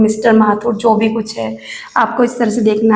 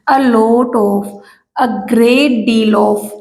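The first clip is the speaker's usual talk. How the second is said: slow dictation; much slower than usual. Shown as a demonstration. Teacher dictates phrases slowly.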